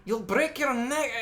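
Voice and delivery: put-on high, slow German accent slipping into Irish; botched German accent. Imitating German godmother warning a child, botched Irish-sounding German accent. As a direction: attempt German, land on Irish, pitched high and loud. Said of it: "I, that was Irish".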